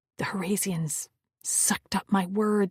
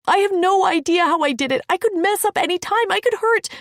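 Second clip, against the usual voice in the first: high frantic voice; panicked; 11 semitones higher, 10 dB louder than usual. Acted. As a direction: spill it in panic. Panicked confession.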